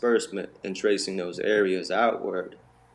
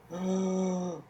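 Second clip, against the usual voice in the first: high, slow awestruck "oh"; mock-reverent kids' chorus; awed kids; 6 semitones higher, much slower than usual. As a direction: play the friends stunned and reverent. Friends in awe of him.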